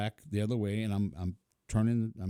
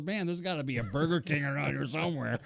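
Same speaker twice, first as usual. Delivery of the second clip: mimicking fiancee; 6 semitones higher than usual. Quoting fiancee, high voice.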